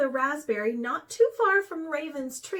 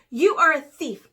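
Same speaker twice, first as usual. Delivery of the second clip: loud accusation; accusing; 10 dB louder than usual. A narrator's reading. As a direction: hurl the accusation. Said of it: "accused Mouse".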